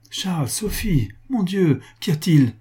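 high-pitched, alarmed exclamations; distraught cry. A narrator's reading. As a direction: cry out in shock and worry. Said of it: "s'écria, la figure bouleversée".

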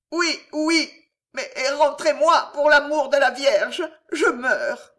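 high, strained begging; desperate pleading. A narrator's reading. Innkeeper begging to be pulled back inside, desperate. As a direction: plead as if about to die. Dangling innkeeper begs for life.

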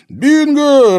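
high, loud gleeful cry; joyful exclamation. Acted. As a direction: shout it in triumph. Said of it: "радостно воскликнул Герман".

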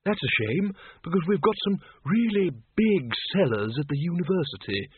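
quick-witted Windle. A narrator's reading. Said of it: "said Windle Poons quickly".

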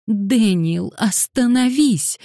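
high, urgent plea; pleading. A narrator's reading. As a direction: beg him to stop, pitch well up. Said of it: "взмолилась Ганория". (умоляюще, высоким голосом)

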